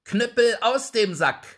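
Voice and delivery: laut und viel höher gerufen; gerufener Befehl. Acted. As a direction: schleudere den Befehl dem Dieb entgegen. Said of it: "rief er". (der Drechsler ruft dem diebischen Wirt laut den Befehl zu)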